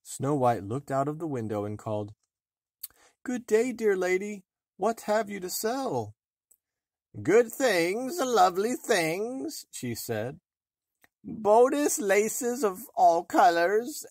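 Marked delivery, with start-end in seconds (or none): 3.2-6.2 put-on high, light girlish voice; girlish and higher; sweet young girl. A narrator's reading. 7.2-9.6 high, slow wheedling crone; old peddler woman. A narrator's reading. Disguised queen as peddler. 11.2-14.0 high, slow wheedling crone; old peddler woman. A narrator's reading. Disguised queen as peddler.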